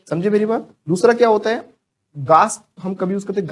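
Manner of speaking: ordinary talk, nothing marked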